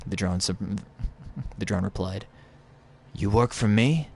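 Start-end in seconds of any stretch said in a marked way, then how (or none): 3.1-4.1 pitched-up stranger's voice; Drifter impression. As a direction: play the rough-living wanderer, higher. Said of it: "I'm gonna do my Drifter impression".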